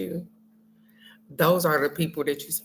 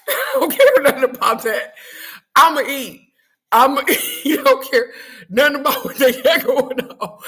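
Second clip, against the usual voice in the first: high, loud laughing talk; 7 semitones higher, 6 dB louder than usual. Real, not acted. Telling viewers she'll eat anyway, laughing loudly. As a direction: laugh through it, much higher and louder.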